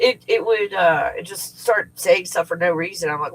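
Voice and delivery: normal talking voice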